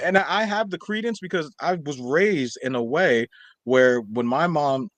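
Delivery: normal talking voice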